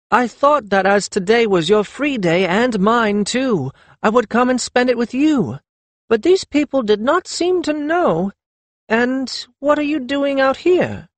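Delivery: high-pitched, excited woman's chatter; exclaiming. A narrator's reading. Lettuce finding her younger friend at last, exclaiming. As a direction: pour it out to a friend. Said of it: "she exclaimed".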